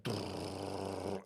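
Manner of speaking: rolled drrr like a cash counter; machine whirr. Retold or imitated. Imitating the bank's money counter, a rolled whirr. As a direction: buzz your lips like a counting machine. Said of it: "I hear the machine go like".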